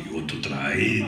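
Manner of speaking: deep, loud giant's voice; Andre. Retold or imitated. Giant urging his co-star to ride, low and booming. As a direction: drop right down and boom the offer. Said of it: "Yes, Andre".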